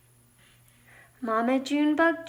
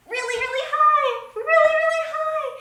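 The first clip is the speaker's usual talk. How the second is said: quick, very high squeal of a voice; playful high-pitched rush; high bounces; 13 semitones higher, 3 dB louder, much faster than usual. Pretend. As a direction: bounce the words way up high, fast. Plays at bouncing high with words.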